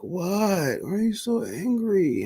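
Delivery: imitating a puzzled mom. Mom voiced in story.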